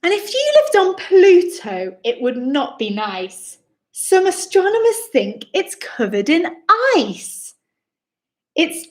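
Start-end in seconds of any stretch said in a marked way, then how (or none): none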